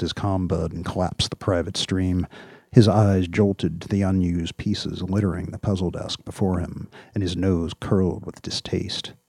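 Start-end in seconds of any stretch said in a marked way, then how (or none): none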